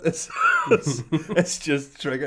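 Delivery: high, slow, laughing till crying; helpless tearful laughter; laughing to tears. Real, not acted. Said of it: "I'm crying talking about it".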